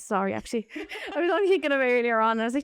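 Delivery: normal talking voice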